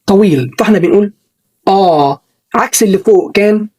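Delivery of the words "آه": a drawn-out open "آه"; long ah. Shown as a demonstration. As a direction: open wide and hold "آه". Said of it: "الصوت ده long، طويل".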